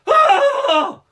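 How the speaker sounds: high, loud hammed-up scream; a shriek of sudden shock; piercing fake scream in line for a snow cone; mock scream. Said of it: "I was like".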